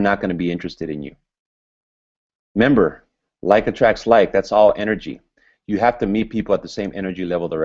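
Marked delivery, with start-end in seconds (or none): none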